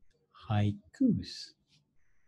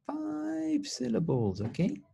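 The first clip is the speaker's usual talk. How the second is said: playful high sing-song; slower than usual. Shown as a demonstration. Teacher playful high reminder.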